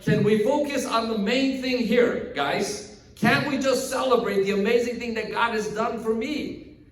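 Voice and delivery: high-pitched, pleading exasperation; exasperated. Acted. Healed man begging his questioners to celebrate, exasperated. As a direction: plead with them to get the point. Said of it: "He's, like, so exasperated".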